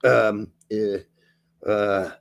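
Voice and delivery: put-on lightly German-accented coach quote. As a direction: read it like Pochettino, German accent. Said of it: "your German accent for Poach".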